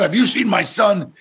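too tough. Retold or imitated. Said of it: "You sound like you're doing an armed robbery".

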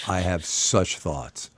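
low, slow and measured; slow, weighty. Real, not acted. Host reacting to the dress code poll, slow and weighty. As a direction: say it slowly, dropped low. Weighty opinion, repeated with growing emphasis.

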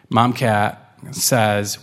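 plain reading voice

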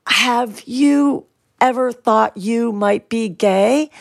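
drawn-out, careful question; very slow. Retold or imitated. Daughter nervously asking her father by the pool, very slowly. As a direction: ease each word out, slowly and bravely. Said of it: "I said it so slowly".

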